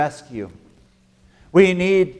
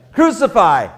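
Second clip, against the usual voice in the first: crowd's shout; 6 semitones higher, 7 dB louder than usual. Acted. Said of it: "what do we say".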